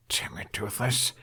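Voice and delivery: hushed, breathless whisper; whispered curse. Acted. Hiccup to Toothless in front of giggling girls, whispered curse.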